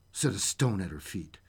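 ordinary delivery, nothing marked

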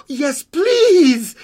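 put-on very high, loud little-girl voice; eager little girl. Girl accepts creature's offer, high eager squeal. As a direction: squeal it with delight.

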